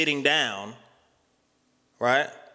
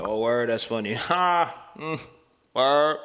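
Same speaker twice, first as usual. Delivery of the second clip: awkward fake amusement; 2 semitones higher, slower than usual. Shown as a demonstration. Showing how he talks when uncomfortable, forced amusement. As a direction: demonstrate laughing along uncomfortably. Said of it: "I'll start talking like this".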